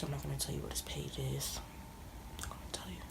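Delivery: hushed whisper, teasing secret. Real, not acted. Whispering to viewers that she won't reveal his page.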